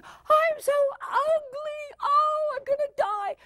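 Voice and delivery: high, stretched-out melodramatic wail; wailing teenager. Acted. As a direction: play the teen drama queen, near tears. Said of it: "they go".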